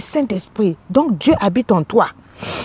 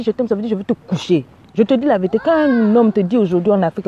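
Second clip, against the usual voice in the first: ordinary talk, nothing marked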